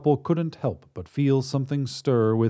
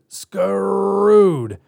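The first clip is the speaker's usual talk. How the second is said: louder, higher shout of the word; emphatic shout; 9 semitones higher, 7 dB louder than usual. Acted. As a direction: punch the repeat hard. Alien repeats for emphasis.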